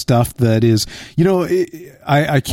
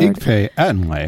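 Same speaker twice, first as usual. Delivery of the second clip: mock Pig Latin prompt; 2 semitones lower than usual. Pig Latin phone prompt parody.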